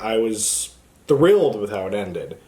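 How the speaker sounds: usual speaking voice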